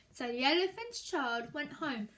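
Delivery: ordinary delivery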